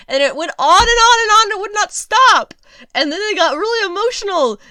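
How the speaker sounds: high and loud, building up exasperated; ranting in disbelief, voice climbing; fed-up critic ranting high and loud about the singing. Real, not acted.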